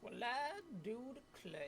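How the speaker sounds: put-on Kentucky accent, gentleman style. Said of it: "I cannot do a Kentucky accent".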